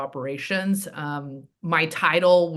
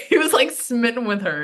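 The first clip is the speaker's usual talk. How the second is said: higher, through real laughter; laughing; 7 semitones higher, 4 dB louder than usual. Real, not acted. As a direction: tease him while laughing. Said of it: "He'll kill me for that".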